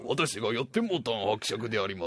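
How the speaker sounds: low, soft pompous count introducing himself. Pretend. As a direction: play a stately count. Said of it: "みたいに出てくるんだ". (低く小さい声で気取った伯爵)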